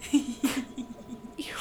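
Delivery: slow, laughing half-shout. Real, not acted. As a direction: push it out through laughter.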